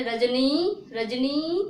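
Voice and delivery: रजनी with the final नी held long, pushed; forced long vowel. Shown as a demonstration. Teacher testing a long ई in रजनी, final नी stretched. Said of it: "जोर लगा के बोलते हैं".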